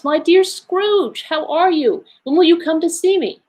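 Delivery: effusive, sing-song welcome; gladsome greeting. A narrator's reading. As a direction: greet an old friend gladly, voice lifted high. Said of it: "to say with gladsome looks".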